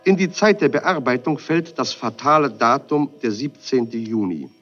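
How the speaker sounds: ordinary talk, nothing marked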